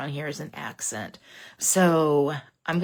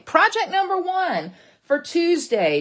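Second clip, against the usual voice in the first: cheery announcement; 11 semitones higher, 7 dB louder, slower than usual. Real, not acted. Sign-off announcement.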